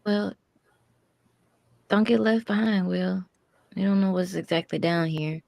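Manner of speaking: character voice. Acted. Character warns Willow, louder.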